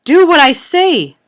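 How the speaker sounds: high, very loud, drawn-out order; shouted command. A narrator's reading. Mother slapping her reluctant daughter, shouting. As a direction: shout it slowly as an order not to be argued with. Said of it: "she shouted".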